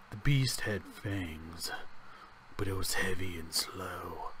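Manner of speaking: low, soft storyteller voice; hushed story narration. A narrator's reading. Gamer reading the found note aloud, hushed narration. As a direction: read it quietly and gravely, letting pitch sink.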